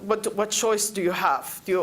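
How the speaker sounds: ordinary talk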